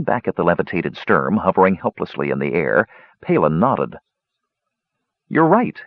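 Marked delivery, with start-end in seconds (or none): 5.3-5.8 lifted, proud declaration. A narrator's reading. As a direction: say it with pride, pitched up. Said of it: "he said proudly".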